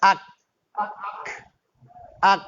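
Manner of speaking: আক ending in a stopped ক with a light bounce, not a breathy খ; crisp ক. Shown as a demonstration. Teacher models the right ক for students, crisp. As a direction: clip আক hard on ক. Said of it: "আখ না কিন্তু".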